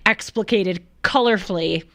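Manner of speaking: ordinary talk, nothing marked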